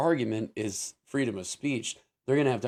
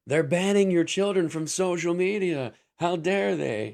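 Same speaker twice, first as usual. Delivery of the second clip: higher-pitched alarmist headline voice; mock media outrage; 7 semitones higher, 4 dB louder than usual. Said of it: "the way the media portrayed it was".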